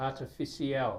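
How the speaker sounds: "artificial" ending in two syllables, "see-al"; common mispronunciation. Shown as a demonstration. Tutor showing how people mistakenly say it, mispronounced. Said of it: "some people mistakenly".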